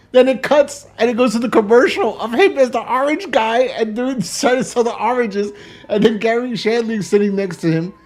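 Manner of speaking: high, loud telling through laughter; laughing while talking. Real, not acted. Podcaster telling his favorite episode's punchline, laughing while talking. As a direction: crack up as you tell it.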